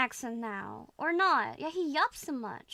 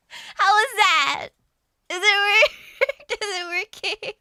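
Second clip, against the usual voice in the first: high, loud, breaking into laughter; giggly plea for approval; 7 semitones higher, 9 dB louder than usual. Real, not acted. Student asking if the accent works, high laughing voice. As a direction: ask eagerly, cracking up.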